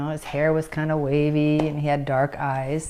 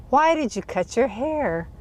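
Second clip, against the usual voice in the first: higher-pitched imitation of his challenge; retold demanding question; 7 semitones higher than usual. Driver questioning her about cutting her hair, retold higher demand. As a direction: question her sharply, as he did.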